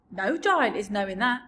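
rumbling kindly giant; BFG voice. A narrator's reading. BFG character voice.

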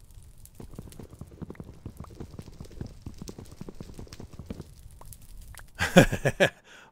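breathy unvoiced laugh. Real, not acted. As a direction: laugh to yourself. Laughing at falling burning tree.

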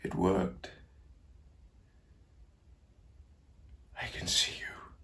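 soft voice falling into a whisper; awed hush. Acted. Old man sees the ghosts appear, awed whisper. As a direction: barely breathe it, as if seeing spirits.

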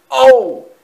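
very loud, high "oh!"; startled cry. A narrator's reading. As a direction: yelp at a sudden collision. Engine hits the dangling boy.

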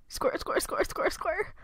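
soft unvoiced whisper; hushed, sneaking past. Real, not acted. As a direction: breathe the words, barely audible. Hushed counting while sneaking.

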